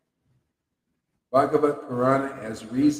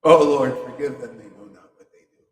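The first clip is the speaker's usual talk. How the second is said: mock prayer; 3 semitones lower, 8 dB louder than usual. Devotee praying to the Lord for those who don't know, fading mock prayer. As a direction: pray for them, dropping away to almost nothing.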